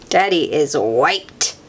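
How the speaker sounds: ordinary delivery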